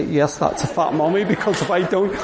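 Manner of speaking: sheepish laughing retelling; embarrassed laughing. Real, not acted. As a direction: laugh at your own mortification. Mortified in the barber's.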